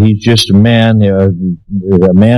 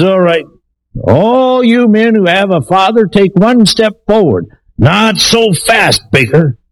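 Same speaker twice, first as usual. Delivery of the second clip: high-pitched, drawn-out parade-ground order; mock drill-sergeant yell; 11 semitones higher than usual. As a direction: imitate a sergeant calling the men out. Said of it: "yelling at 'em and screaming".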